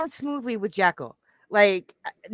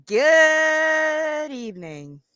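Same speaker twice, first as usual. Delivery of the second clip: slow, stretched, higher showman's good evening; drawn-out greeting; 6 semitones higher, 4 dB louder, much slower than usual. Real, not acted. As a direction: stretch the greeting like a stage host. Host greets audience drawn out.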